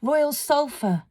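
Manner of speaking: breathless, high plea; gasped. A narrator's reading. Frightened girl answering the fire fiend, gasped. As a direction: gasp it out in fear. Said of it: "she gasped".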